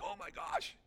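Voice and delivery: unvoiced gasp of dread; hushed, horrified whisper; awestruck whisper. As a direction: whisper it as if stunned. Sailors stunned, freaking out.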